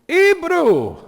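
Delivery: put-on loud, very high Venezuelan-accented exclamation, H dropped from "Hebrew"; botched Venezuelan accent. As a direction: cry out "Hebrew" as "Ee-broo" in a bad Venezuelan accent. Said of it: "really butcher his accent".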